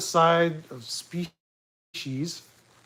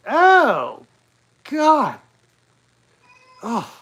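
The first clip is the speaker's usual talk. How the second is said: hammed-up disgusted shriek, higher and louder; fake squeamish; 8 semitones higher, 8 dB louder, much slower than usual. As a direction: overplay being grossed out. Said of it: "The, the fake squeamishness".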